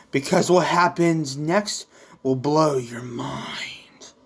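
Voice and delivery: slow, hushed teaser whisper; dramatic whisper. Acted. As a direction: whisper it like a big secret. Teasing a mind-blowing reveal.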